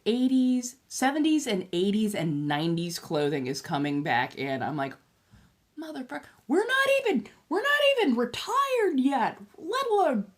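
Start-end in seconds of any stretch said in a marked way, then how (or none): 5.8-10.3 exasperated rant. Retold or imitated. Ranting at retro fashion coming back.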